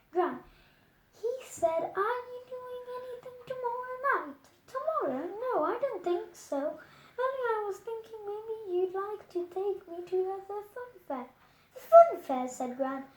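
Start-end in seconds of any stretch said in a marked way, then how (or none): 2.0-11.3 put-on higher, quick, hopeful little boy; a hint of a kid angling for a treat; lightly voiced eager boy. A narrator's reading. Bertie hinting to Gran about the fair, eager and quick. 11.7-12.5 mild surprise. A narrator's reading. Gran surprised at request.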